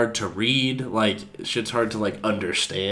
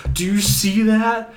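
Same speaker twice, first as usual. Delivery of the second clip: high, slow trippy wonder; acted-out stoned awe; retold amazement; 11 semitones higher, 4 dB louder, slower than usual. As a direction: marvel at it, stretched out and high. Tripping, urgently showing friend the cup.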